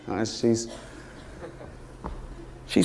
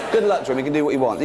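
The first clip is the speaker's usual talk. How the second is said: usual speaking voice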